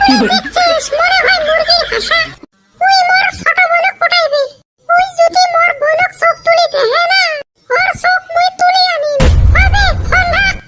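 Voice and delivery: put-on high, shouting young woman's voice, furious; angry girl on phone. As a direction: screech at him like an angry girlfriend. Moyna angry about little brother.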